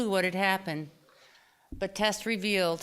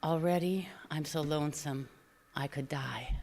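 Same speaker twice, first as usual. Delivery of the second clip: soft, unvoiced whisper; whispered song line, much softer; 3 semitones lower, 5 dB quieter than usual. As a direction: whisper the lyric to yourself. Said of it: "my voice whispering the line".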